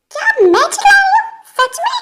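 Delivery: high-pitched put-on innocence; indignant disbelief. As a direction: squeak out how dare you accuse me. Girl denies shouting, indignant.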